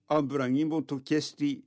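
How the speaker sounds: usual speaking voice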